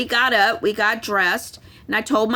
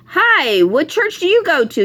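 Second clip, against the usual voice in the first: mock friendly greeting; 7 semitones higher, 6 dB louder than usual. Mock church recruiter greeting.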